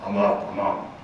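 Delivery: put-on groggy mumble of a kid woken up; a hint of a grumpy teen on the walkie-talkie. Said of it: "this grumpy voice".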